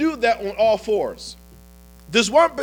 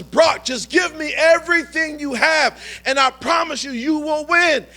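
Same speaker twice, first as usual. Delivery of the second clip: coach's voice raised high, shouting encouragement; urging shout; 6 semitones higher than usual. Retold or imitated. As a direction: bellow the promise like a coach on the field. Coach urges Brock, shouting.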